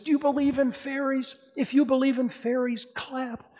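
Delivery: rally the audience to clap; high eager boyish voice asking for belief; Peter Pan urging. Retold or imitated. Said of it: "Peter Pan says".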